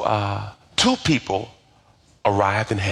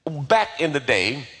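ordinary talk

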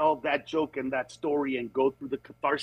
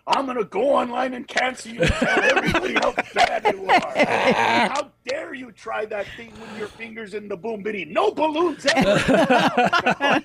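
mock outrage; 7 semitones higher than usual. Mock outrage at bad lover.

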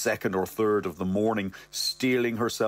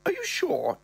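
high falsetto mother; mother's voice. Retold or imitated. Mother doubting her son, high falsetto. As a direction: ask it in a high put-on voice.

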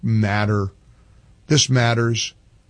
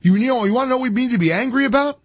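higher and fast, voice climbing with indignation; heated outburst; 10 semitones higher, much faster than usual. Real, not acted. Radio host riling up his audience, rising fast and heated. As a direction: fire the question at the listeners. Host repeats rant question.

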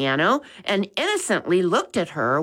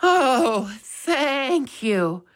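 sighed; 7 semitones higher, much slower than usual. A narrator's reading. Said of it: "Missus Baker sighed".

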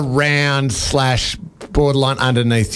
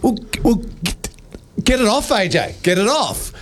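higher-pitched, stammering plea; retold panic; 6 semitones higher than usual. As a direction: act out mild panic, stumbling at the start. Retelling own panic about spider.

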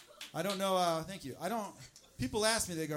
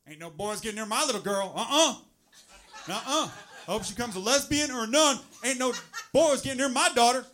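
loud Southern overprotective dad, higher; 5 semitones higher, 9 dB louder than usual. Retold or imitated. Comic mocking the protective dad, loud Southern accent. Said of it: "I make him Southern".

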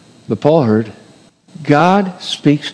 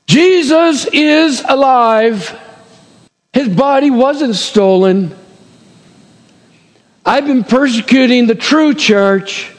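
higher, slow, dawning shock; stunned realization; 7 semitones higher, slower than usual. Acted. Preacher voicing Paul's thoughts, slow and awestruck. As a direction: let each truth land on him one by one. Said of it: "what may have gone through this adrenaline rush within his mind".

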